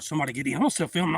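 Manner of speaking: ordinary talk, nothing marked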